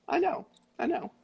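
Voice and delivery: soft, high soothing murmur; soothing reply. Acted. Jesus soothing his adoring disciples.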